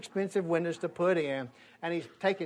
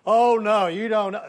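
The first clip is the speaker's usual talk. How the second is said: gleeful warning; 6 semitones higher, 11 dB louder than usual. Retold or imitated. Officer's gleeful aside.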